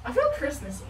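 usual speaking voice

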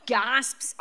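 usual speaking voice

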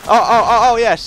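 high, loud burst of victory; triumphant yell. Real, not acted. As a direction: shout it out as the kill lands. Gets a kill in the game.

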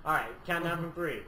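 ordinary talk

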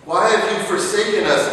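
loud, high cry of why to God; preacher pleading loudly with God, anguished; loud plea. Acted. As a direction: hurl the question upward. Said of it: "pleading cry to God".

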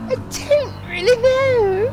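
very high, loud, drawn-out falsetto; Mavis impression. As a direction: stretch each word out in a piercingly high voice. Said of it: "for my Mavis impression".

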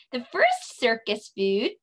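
ordinary delivery

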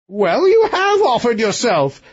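high king voice, retorting. A narrator's reading. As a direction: snap it back as the king. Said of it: "retorted the king".

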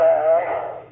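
normal talking voice

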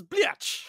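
"bliatch" said high, blee-atch with a ch ending; mock her garbled "bliatch" up high; mangled swear. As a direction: pitch "bliatch" high and silly. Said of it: "it just comes out as".

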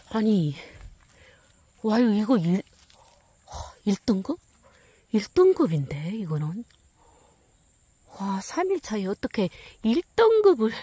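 loud thrilled exclaiming; shrieking jackpot winner. Real, not acted. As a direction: yell it like you hit the jackpot. Finds first-grade mushroom.

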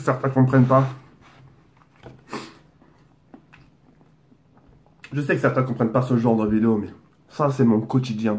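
speaking between bites; chewing while talking. Real, not acted. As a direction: mouth partly full of food. Seen 4: Burger in hand, lips pursed and cheeks working between words throughout.

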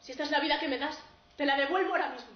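forceful final outburst; defiant declaration. Acted. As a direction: hurl it back at life. Defiant last words before hanging himself.

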